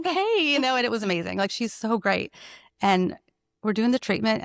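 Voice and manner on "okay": high amazed okay. Retold or imitated.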